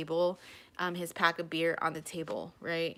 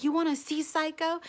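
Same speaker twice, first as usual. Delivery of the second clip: defiant taunt; 9 semitones higher, 4 dB louder than usual. Retold or imitated. Acted quarrel with ex.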